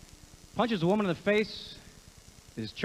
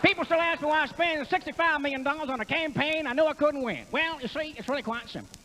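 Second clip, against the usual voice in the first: higher, twangy Texas billionaire; Ross Perot; 9 semitones higher than usual. Acted. Comic playing Ross Perot explaining his campaign, high twang. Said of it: "Ross Perot".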